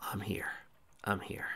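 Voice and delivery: breathy unvoiced whisper; whispering comfort to a sleeping woman. A narrator's reading. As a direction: murmur it gently so she stays asleep. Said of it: "he whispered".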